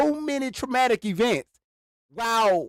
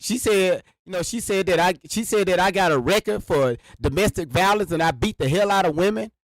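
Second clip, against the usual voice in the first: ordinary talk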